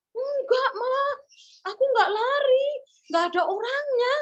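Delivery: high-pitched tearful denial; whining child. Acted. Boy denying to mother he ran away, whining. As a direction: protest like a scared little boy.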